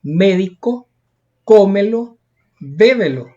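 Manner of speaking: spaced examples médico, cómelo, bébelo, each hit hard at the start; antepenultimate stress. Shown as a demonstration. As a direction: punch the first syllable of médico, cómelo, bébelo. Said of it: "fuerza de voz en la antepenúltima sílaba".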